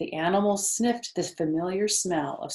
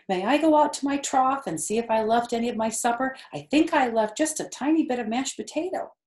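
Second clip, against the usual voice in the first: eager piglet; 5 semitones higher, 4 dB louder, faster than usual. Acted. Wilbur asks eagerly.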